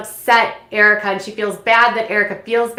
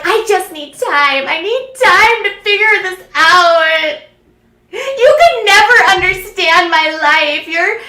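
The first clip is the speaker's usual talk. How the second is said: high, loud, slow mock wail; hammed-up anguished plea; 9 semitones higher, 6 dB louder, slower than usual. Girlfriend begs for time to figure it out, hammed-up wailing. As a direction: drag out each word in melodramatic protest.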